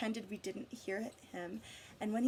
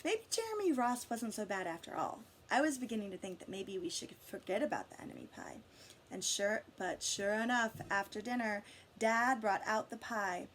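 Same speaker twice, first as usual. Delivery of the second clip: ordinary delivery, nothing marked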